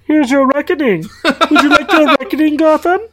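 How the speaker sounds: high, loud hammed-up Bane voice. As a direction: ham up a masked villain's threat. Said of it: "Sean Connery?".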